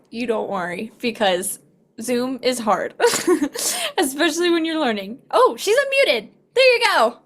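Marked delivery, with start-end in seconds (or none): 3.0-3.9 a quick high burst of laughter; high giggle at the tech trouble; bright laugh. Real, not acted. Laughing at Zoom struggles. 4.0-5.2 laughing. Real, not acted. Laughing at Zoom struggles. 5.3-7.2 higher and louder, delighted; happy relief when the guest comes through; excited. Real, not acted. Guest finally unmutes on call.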